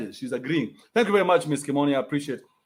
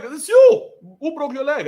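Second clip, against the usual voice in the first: much higher, louder yell; outburst; 8 semitones higher, 6 dB louder, slower than usual. Acted. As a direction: snap it back at her. Shouting back at child.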